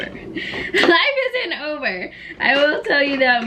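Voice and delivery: high, slow, laughing, half crying; laughing through it. Real, not acted. Vlogger reassuring heartbroken viewers, laughing hard. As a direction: laugh the words out as you stretch them.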